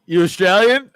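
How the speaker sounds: imitated drunken demand; confrontational question. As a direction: throw it out like a belligerent drunk. Drunk cousin confronting the women.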